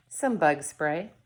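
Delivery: ordinary delivery, nothing marked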